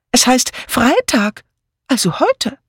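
anxious friend. Acted. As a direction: spell it out in a high anxious voice. Anxious friend voiced in story. (hohe Stimme der besorgten Freundin)

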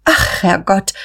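mild cry of sudden realisation; sudden exclamation. A narrator's reading. Guste suddenly notices how late it is, mildly startled. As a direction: exclaim with a touch of alarm. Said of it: "rief Guste plötzlich".

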